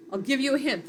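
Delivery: normal talking voice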